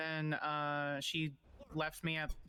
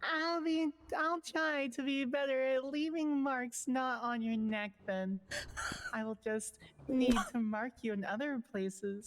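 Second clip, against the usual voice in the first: a hint of Southern, pitched much higher; lightly Southern, lifted high and teasing; Southern accent; 9 semitones higher than usual. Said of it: "I don't know why they're both Southern".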